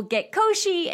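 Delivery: high, playful little squeal; goofy catchphrase. Retold or imitated. Cousins saying it all through high school, goofy excitement. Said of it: "we said it all through high school".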